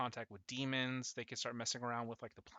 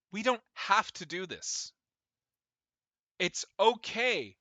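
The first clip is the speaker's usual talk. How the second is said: high, loud pleading figure's voice; modulated voice; 8 semitones higher, 11 dB louder than usual. Acted. Shadowed figure pleading in front of the gods, modulated voice. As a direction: pitch it high and loud, swooping down at the end. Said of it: "the voice is very, like, modulated".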